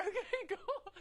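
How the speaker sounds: very high, laughing squeak; mock-cheerful agreement. Injured daughter agreeing with dad, mock-cheerful.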